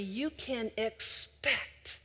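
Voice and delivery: slow and deliberate; slow, weighty build. Shown as a demonstration. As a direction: lay each word down slowly. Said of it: "And when you listen carefully".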